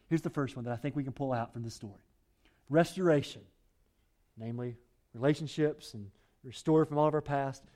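usual speaking voice

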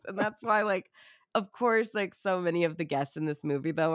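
normal talking voice

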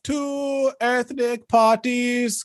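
high, slow herald proclamation; town crier. Acted. Opens negotiation as herald.

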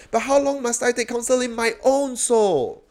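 high, pressing plea to God; anguished cry. Acted. Preacher restating the psalmist's question, anguished cry. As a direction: plead it as an insistent lament. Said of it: "Do you have that as your cry?".

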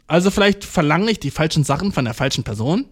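ordinary talk, nothing marked

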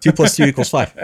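joking that numbers are made up; mock proclamation. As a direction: declare it like a slogan. Mock absurd declaration.

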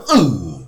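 loud, drawn-out West Coast "Uh"; heavy on the "uh". Shown as a demonstration. Showing how LA homies talk, heavy loud "Uh". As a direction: hit "Uh" hard and stretch it. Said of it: "They heavy on the uh".

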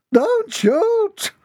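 very high squeal; panicked plea. A narrator's reading. Bush's inhabitant begs the captain, panicked falsetto squeal. Hidden mother begs him.

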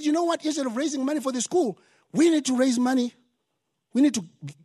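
usual speaking voice

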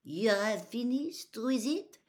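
put-on lightly French-accented, slow polite question; mild French accent. French girl asks, accented.

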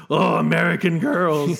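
mock-lament it slowly; Ian Holm. Podcaster quoting a film character, slow mock exclamation. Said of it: "Ian Holm says".

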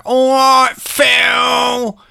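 loud, high, hammed-up shouted greeting. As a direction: bellow it high like an obnoxious party guest. Imitates [name] barging in.

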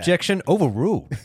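lightly voiced courtroom ruling; mock judge. Hosts riffing on a courtroom, hammed-up ruling.

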